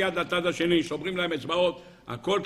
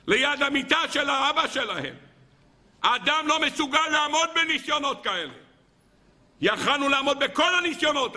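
impassioned preaching; 8 semitones higher than usual. Acted. Preacher tells congregation no man withstands this, fervent. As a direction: preach it with full force.

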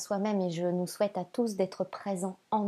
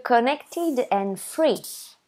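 the oracle's name said slowly in an English accent, higher and louder; 4 semitones higher, 5 dB louder, much slower than usual. Shown as a demonstration. Introducing the oracle deck to viewers in an English accent. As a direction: announce the deck proudly with your best English accent. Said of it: "j'espère que mon accent, euh, is good".

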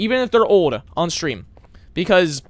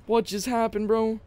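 soft, raised-pitch repeat; baffled aside; 4 semitones higher, 5 dB quieter than usual. Real, not acted. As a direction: ask it softly, baffled. Repeats it more quietly.

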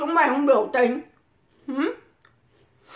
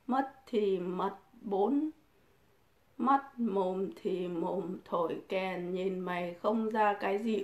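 soft, slow, flat read-out of the insults; slow, low-key reading of a chat comment; reading comments quietly; 4 semitones lower, 8 dB quieter than usual. Retold or imitated. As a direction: murmur the viewer's words back slowly. Reading viewer insults aloud.

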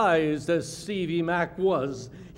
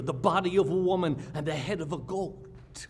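ordinary delivery

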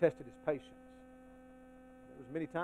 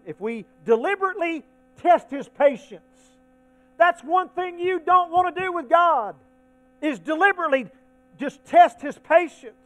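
high, loud preacher's warning; full-force preaching; 9 semitones higher, 10 dB louder than usual. Acted. Preacher warns the congregation about testing God, full-force preaching. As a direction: press the warning home loudly from the pulpit.

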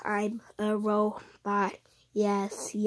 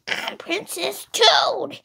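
higher put-on princess voice; Princess Toad voice; 10 semitones higher, 5 dB louder than usual. Showing off a favorite voice when asked, high princess voice. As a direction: pitch it up like a dainty little princess. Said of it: "let me perform the voice".

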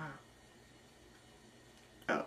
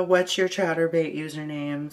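ordinary delivery

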